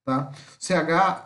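ordinary talk

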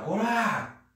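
high drawn-out aggressive cry; picking a fight. Shown as a demonstration. About to fight with a guy, aggressive yell. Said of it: "for brigar com o cara lá".

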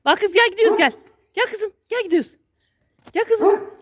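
high, urging call to a pet; calling the dog. Real, not acted. Owner tells his dog they are going, high urgent calling.

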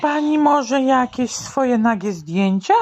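put-on high kid voice; high boy voice. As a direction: ask like a cheeky schoolboy. Jasiu asks teacher.

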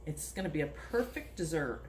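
ordinary talk

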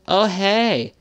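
put-on high, loud creepy kid greeting; sing-song a creepy child popping up; creepy little kid. Imagined creepy kid at the top of the stairs, high and loud. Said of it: "this creepy-ass little kid going".

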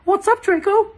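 hammed-up girlish voice; girlish eager voice. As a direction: squeak it like a fangirl. Narrator voices eager Ebony.

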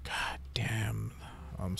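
whispered curse under the breath; frustrated whisper. Real, not acted. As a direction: hiss it to yourself. Muttering in frustration.

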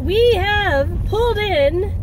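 talking while the car shakes; on a bumpy road. Real, not acted. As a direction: voice jolted by bumps. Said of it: "Sorry for the shaking".

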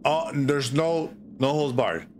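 ordinary delivery, nothing marked

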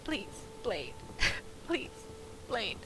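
soft, tearful mock begging; hammed-up sobbing plea to a missing wolf; mock crying plea. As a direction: beg him quietly, pretending to cry. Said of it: "I'm begging you".

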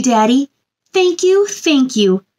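ordinary delivery

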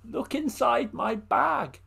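slow and knowing, a lightly voiced old woman. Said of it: "the old woman said".

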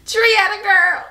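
high teary outcry; touched outcry. Real, not acted. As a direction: call out to a friend who made you tear up. Moved by gift, calling friend.

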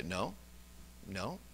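quiet under-breath no. Shown as a demonstration. Preacher showing congregation his quiet refusal, murmured. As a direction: say it quietly to yourself. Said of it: "just under my breath".

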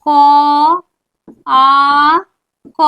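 ক, আ, ক as separate sounds with long pauses; sound split. Shown as a demonstration. Teacher splitting কাক for students, sound by sound. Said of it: "অর্থাৎ কি হচ্ছে".

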